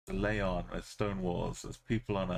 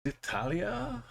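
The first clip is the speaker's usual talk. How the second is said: very high rising question; incredulous question; 13 semitones higher, slower than usual. Real, not acted. Player questioning a country guess, high incredulous rise. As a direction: swoop right up at the end.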